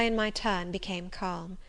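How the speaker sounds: ordinary delivery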